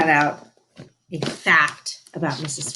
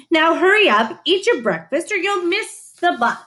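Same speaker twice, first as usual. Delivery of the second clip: higher, louder mother hurrying her along; 10 semitones higher, 5 dB louder than usual. A narrator's reading. Mother hurrying Tina to catch the bus, higher and louder. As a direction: chivvy the child out the door.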